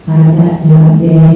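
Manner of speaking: low, slow musing voice; low, slow mock musing; distracted mind. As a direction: let the thought wander to prices. Prayer thoughts drift to chili prices.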